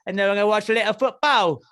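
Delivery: hammed-up high British accent; mock British accent. Podcaster teasing football fans in a mock British accent. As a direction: put on a silly posh English voice.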